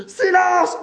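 very high, full-force shout; yelling. A narrator's reading. Bailey silencing everyone, yelling. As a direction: yell it at the top of your voice. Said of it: "hurla Bailey".

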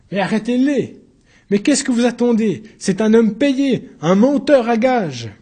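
high, loud plea, slowing on the last words; cornered stag crying for help; desperate shouting. Acted. Accused begging the judges to arrest the liar, loud. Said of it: "comme un cerf cherchant une issue".

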